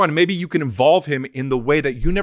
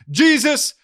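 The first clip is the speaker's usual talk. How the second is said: mock preacher; 8 semitones higher, 3 dB louder than usual. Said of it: "someone speaks and they're like".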